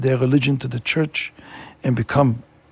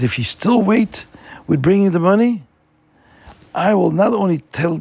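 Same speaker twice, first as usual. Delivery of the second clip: higher, louder mock threat; retold yelling; 6 semitones higher, 3 dB louder than usual. Landlord threatening the parents over the money, mild mock yell. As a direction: bark the threat at the parents, hammed-up. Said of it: "he started yelling at them".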